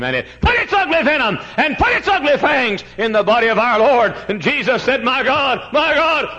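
high, loud sustained pulpit cry; impassioned preaching. Real, not acted. Preacher tells congregation of the crucifixion, impassioned high shout.

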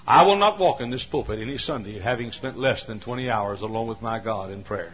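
ordinary talk, nothing marked

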